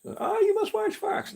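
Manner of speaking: very high, slow sing-song mock voice. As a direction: parody a know-it-all pushing a channel. Mocking people's news advice.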